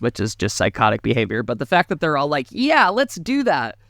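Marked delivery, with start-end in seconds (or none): 2.5-3.8 mock eager partygoers.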